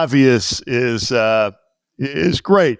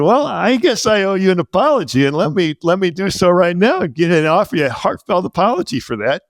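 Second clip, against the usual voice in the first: lightly raised, hammed-up sincere apology; mock heartfelt apology; 6 semitones higher than usual. Apologizing to his old teacher, mock sincere. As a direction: overdo the apology a little. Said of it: "And I said".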